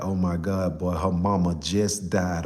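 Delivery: a hint of a grieving grandma delivering bad news; low, hushed grandmother breaking the news; grandma's bad news.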